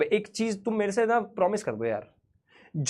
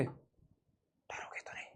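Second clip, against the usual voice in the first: hushed horror-story whisper; mock-scary, very soft whisper; spooky whisper; 23 dB quieter, much slower than usual. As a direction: whisper it slowly to spook the listeners. Teasing before a horror story.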